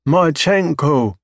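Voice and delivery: high, slow drawn-out alien voice. Acted. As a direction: stretch the name out like an alien learning it. Alien names Marchenko, slow.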